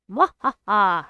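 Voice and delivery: loud evil cackle; a booming villain laugh; sneering panto villain. Acted.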